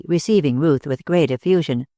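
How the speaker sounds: usual speaking voice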